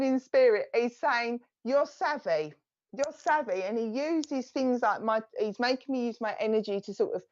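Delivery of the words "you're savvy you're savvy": put-on a spirit's words in a light Essex accent; strong Essex accent. Medium voicing the gentleman in spirit in an Essex accent. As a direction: repeat it high in a broad Essex accent. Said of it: "very strong accent".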